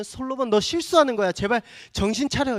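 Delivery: 솔로몬을 향해 높고 크게 호소하듯; 속으로 외치는 듯 다급하게 부르기; 간절한 외침. Retold or imitated. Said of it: "이렇게 소리 지". (영화 속 솔로몬에게 외치는 독자, 높고 큰 호소)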